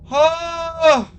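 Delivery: sudden high yelp of fright; startled yelp. Real, not acted. Player caught by the mimic, sudden high startled cry. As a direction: jump out of your skin. Jump scare, death.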